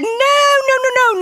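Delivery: high, loud run of no's; emphatic denial. Retold or imitated. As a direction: wave it off, insisting loudly. Denying they planned it together.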